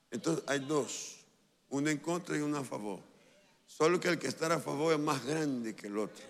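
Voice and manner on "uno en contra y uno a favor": normal talking voice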